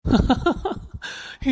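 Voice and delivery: gloating laugh at someone's tears; drawn-out mocking chuckle. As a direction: laugh tauntingly, rising at the end. Man mocks the crying girl.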